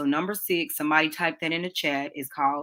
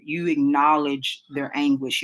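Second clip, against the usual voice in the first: slow and deliberate; slow emphasis; slower than usual. Shown as a demonstration. Teacher making the point to her class, slow and weighty. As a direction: lean on each word slowly.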